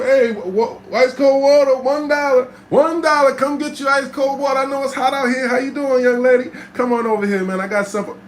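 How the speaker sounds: high, loud street-vendor patter calling people over; hawking water. Retold or imitated. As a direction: sell it to everyone walking by. Kid hawking water to passersby.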